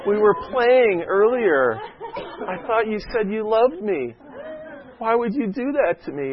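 put-on high, whiny child voice through a laugh; mock-betrayed toddler, pitched high; hurt little kid. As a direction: plead like a wounded three-year-old. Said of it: "he just looked at me like".

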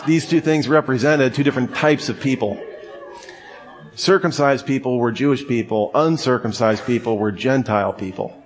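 ordinary delivery

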